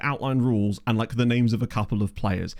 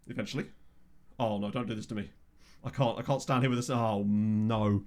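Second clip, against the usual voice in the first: quiet, strained, waiting on a sneeze; stuck sneeze; 2 semitones lower, 7 dB quieter than usual. Real, not acted. Waiting on a stuck sneeze, softer and strained. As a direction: hold back a stuck sneeze. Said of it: "I've got a stuck sneeze".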